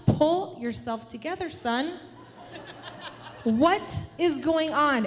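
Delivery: measured out word by word, exasperated; embarrassed scolding. Retold or imitated. Mother to son rolling on the floor, embarrassed scolding.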